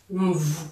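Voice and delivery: Mvu with a humming, buzzing m before the vu. Shown as a demonstration. Teacher shows the buzz in Mvu. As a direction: hum the m into Mvu like a buzzing insect. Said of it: "comme si on entendait le bourdonnement".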